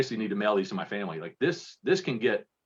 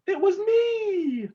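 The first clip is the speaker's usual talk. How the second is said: very high, very slow app-altered voice; a cartoon talking dog made by a voice app; dog confessing through a pitch-shifting app; 4 dB louder, much slower than usual. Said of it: "I used an app where I had the dog just go".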